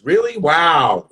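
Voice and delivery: loud, rising really, then heavy wow; mock disbelief. Comic reacting to a woman's boast, loud disbelief. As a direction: react loudly, incredulous. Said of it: "I was like this".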